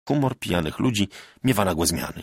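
plain reading voice

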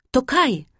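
high, bright-eyed burst of joy; little girl calls out her friend's name in delight; excited cry. Acted. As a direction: cry out "To Kaj!" clapping for joy. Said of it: "zawołała, klaszcząc w ręce Gerda".

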